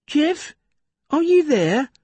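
high, worried call into emptiness; anxious call. A narrator's reading. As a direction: call out, nervous and unsure. Tom calls for vanished friend.

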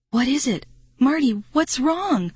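panicked shriek at his friend. A narrator's reading. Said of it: "I shrieked".

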